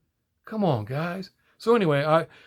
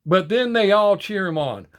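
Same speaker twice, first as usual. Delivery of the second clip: ordinary talk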